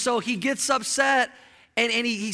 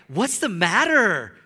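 with a tone; 4 dB louder, slower than usual. Retold or imitated. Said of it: "Jezebel had a tone".

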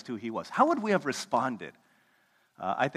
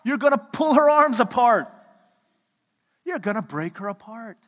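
loud, high parental shout; a parent yelling at squabbling kids; alarmed yell; 9 semitones higher, 10 dB louder than usual. Retold or imitated. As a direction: stop the kids before someone gets hurt. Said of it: "And I'm like".

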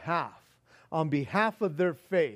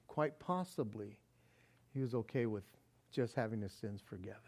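ordinary talk, nothing marked

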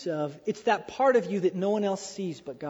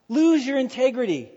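louder, pushing command to give up; loud mock command; 4 semitones higher, 5 dB louder than usual. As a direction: hammer it at him like a bully. Wife's demand retold.

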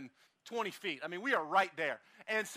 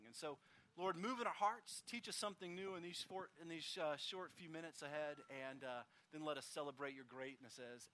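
softer, hushed praying voice; heartfelt prayer; 6 semitones lower, 11 dB quieter than usual. Real, not acted. Pastor praying for the congregation, soft and hushed. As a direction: pray it quietly to God.

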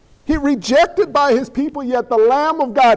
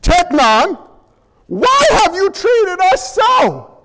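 high, loud shouting mother, slowing down; a mother scolding her son at full pitch; exasperated mother; 6 semitones higher, 3 dB louder, much slower than usual. Retold or imitated. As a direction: cries "Behold!" high and loud. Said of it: "She says".

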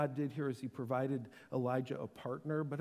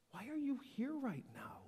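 normal talking voice

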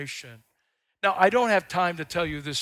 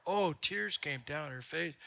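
normal talking voice

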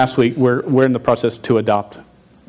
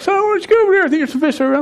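voicing dad; much faster than usual. Dad voiced in story.

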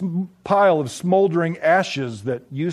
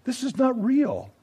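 tearful plea; 6 semitones higher, 4 dB quieter than usual. A narrator's reading. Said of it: "she cried".

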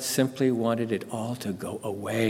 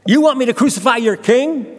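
high, loud, incredulous with stress on want; bewildered question; 11 semitones higher, 6 dB louder than usual. Acted. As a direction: ask it amazed and half-amused, raised and loud. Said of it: "bewildered and bemused".

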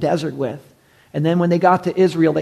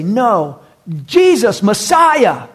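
much higher and louder, crying out the name; passionate shout; 5 semitones higher, 6 dB louder, much slower than usual. Retold or imitated. Preacher voicing blind men crying to Jesus, shouting out. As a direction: call out to him as if from the roadside. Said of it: "or to say".

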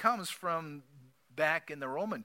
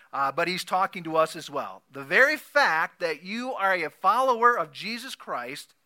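ordinary delivery

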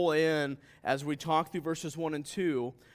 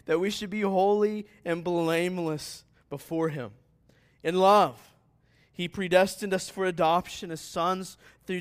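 higher, louder pulpit reading; ringing, emphatic Bible reading; impassioned scripture reading; 5 semitones higher, 6 dB louder than usual. Real, not acted. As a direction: proclaim the verses with mounting force. Reads scripture aloud to congregation.

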